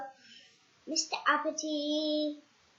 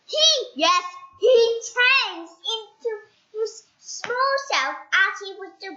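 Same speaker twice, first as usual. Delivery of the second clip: excited telling; 9 semitones higher, 9 dB louder than usual. A narrator's reading. Narrator excitedly telling how he shrinks. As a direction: build the surprise.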